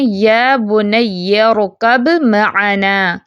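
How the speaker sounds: very slow recitation, ب merged into م in ارکب معنا; tajweed recitation. Shown as a demonstration. Teacher demonstrates idgham of ba into meem, very slow. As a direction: recite یا بنی ارکب معنا with only م heard. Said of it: "با کی کوئی آواز پہچان باقی نہیں".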